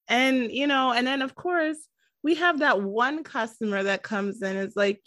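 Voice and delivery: normal talking voice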